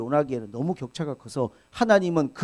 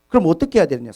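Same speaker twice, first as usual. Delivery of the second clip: ordinary delivery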